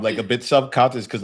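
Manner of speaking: normal talking voice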